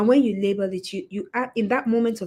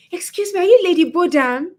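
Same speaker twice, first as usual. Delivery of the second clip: much higher, eager mock voice; bright excited fan, loud on the name; excited stranger; 9 semitones higher, 7 dB louder than usual. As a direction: imitate a fan rushing up.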